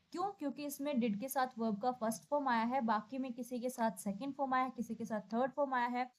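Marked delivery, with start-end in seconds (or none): none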